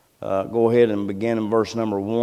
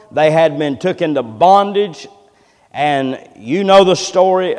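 raised, punchy pulpit delivery; emphatic preaching; 5 semitones higher, 7 dB louder than usual. Real, not acted. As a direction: drive each phrase home to the congregation. Preaches on the verse.

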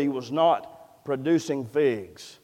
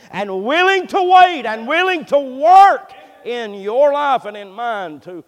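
loud, high-pitched pulpit preaching; full-force preaching; 9 semitones higher, 9 dB louder than usual. Acted. Preacher telling the church of God's patience, impassioned. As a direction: drive each phrase up and out to the congregation.

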